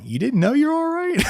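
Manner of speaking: high-pitched, disbelieving question; mock incredulous. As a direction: act out amused disbelief at them. Acting out baffled look at clients.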